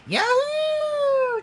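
very high, loud gleeful cheer. A narrator's reading. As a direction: whoop it out at top pitch. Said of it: "cheered Ryan".

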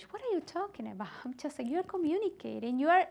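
ordinary talk

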